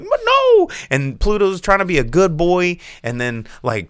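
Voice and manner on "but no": high squeaky chipmunk voice. Retold or imitated. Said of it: "and he's like".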